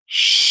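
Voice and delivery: loud, long hushing exhale; healing shh breath. Shown as a demonstration. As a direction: push the breath out as a hush. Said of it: "breathing, hands up, go to the left".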